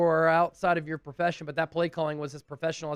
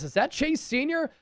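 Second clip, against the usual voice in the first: imitating wife's yell; 8 semitones higher than usual. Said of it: "and yells".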